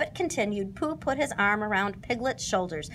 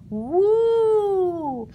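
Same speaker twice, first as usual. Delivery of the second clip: high, drawn-out spooky woo; ghostly moan; 10 semitones higher, 3 dB louder than usual. Acted. Piglet hiding in the dark moans to scare his friends, ghostly woo.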